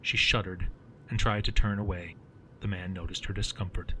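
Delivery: plain reading voice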